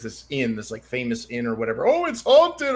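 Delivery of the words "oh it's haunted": high, loud put-on awe; mock spooky hype. Guest retells inn's haunted reputation, mock excited. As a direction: imitate people hyping a ghost story. Mocking hype about a famous inn.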